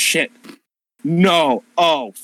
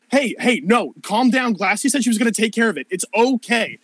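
higher voice, fast reassurance; calming NPC; 9 semitones higher than usual. Acted. Second NPC calming her partner, higher and rushed. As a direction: soothe a panicking friend quickly.

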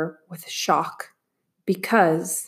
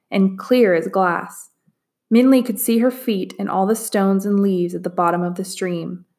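ordinary delivery, nothing marked

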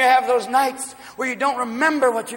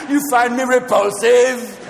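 sleazy pickup line; 4 semitones higher, 4 dB louder, slower than usual. Retold or imitated. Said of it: "so they can go".

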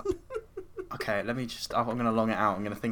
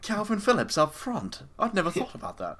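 mock Southgate musing; 9 semitones higher than usual. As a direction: play the manager dreamily inventing a tactic.

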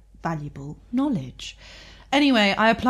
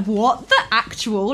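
higher, louder, slow disbelief; slow, loud mock disbelief; stunned; 4 semitones higher, 6 dB louder, slower than usual. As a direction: let the jaw drop on it. Stunned reaction to prediction.

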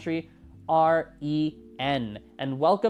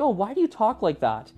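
higher, lightly judging imitation; mock puzzled; 6 semitones higher than usual. Locals quizzing the friend on her speech, higher mild mock judgment. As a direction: poke at how she sounds. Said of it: "Or like".

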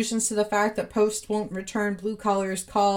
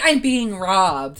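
loud, drawn-out mock cry of robbery; hammed-up outcry, stretched and loud; mock alarm; 7 dB louder, slower than usual. Host imitates Hemsley Post claiming he's being robbed, mock alarm. Retold guard-scuffle victim crying robbery.

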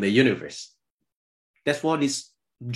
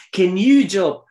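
God's challenge; 2 semitones higher than usual. Acted. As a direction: thunder the first words like God. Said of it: "he continued to say".

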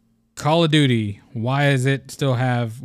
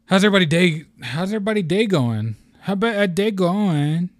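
goofy, higher voice with stretched words; silly put-on voice; 6 semitones higher than usual. Host greets listeners in a goofy voice. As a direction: mangle the greeting in a joke voice. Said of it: "I don't know why I went into that voice".

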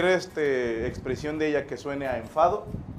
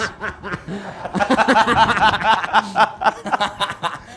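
long loud burst of laughter; 6 semitones higher, 8 dB louder than usual. Real, not acted. Cracking up hard at his own joke, a long loud laugh. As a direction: really crack up.

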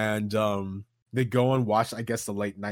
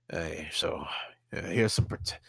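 whispering; 5 semitones lower than usual. Acted. Coworker slipping Arthur a gun.

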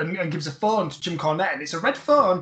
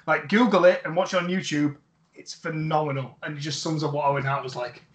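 ordinary talk

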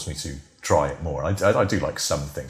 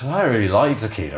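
ordinary delivery

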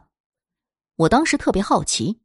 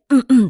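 loud pointed ahem; deliberate throat clearing; 6 semitones higher, 6 dB louder than usual. Retold or imitated. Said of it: "地清了清嗓子".

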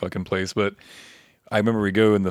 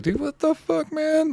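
high-pitched retold disbelief; incredulous protest; slower than usual. Storyteller protests to the girl cracking up, incredulous. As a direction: re-enact an exasperated plea, voice pitched way up.

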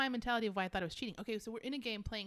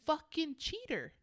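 retold outburst at the TV; 6 semitones higher, 4 dB louder than usual. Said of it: "And I was like".

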